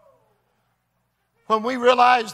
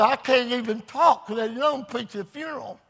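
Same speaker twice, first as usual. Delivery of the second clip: mimicking impaired speech. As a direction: imitate yourself struggling to talk with a half-paralysed mouth. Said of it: "That's the way I talked".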